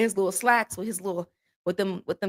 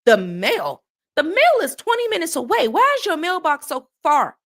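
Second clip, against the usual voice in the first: loud, high comic disbelief; indignant rising outburst; incredulous; 7 semitones higher, 5 dB louder than usual. Retold or imitated. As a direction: protest as if it's absurd. Acted disbelief at the request.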